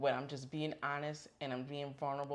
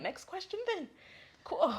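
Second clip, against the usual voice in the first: higher, breezy brush-off; mock dismissive interviewer; 13 semitones higher than usual. Interviewer brushing off her answer, mock breezy. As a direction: toss the answer aside like a bored interviewer.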